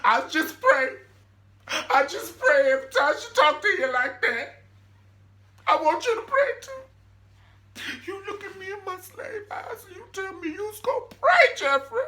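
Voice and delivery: high, loud, wailing put-on; mock crying old mammy. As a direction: wail it like a hammed-up old mother pleading. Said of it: "she just starts speaking full slave language".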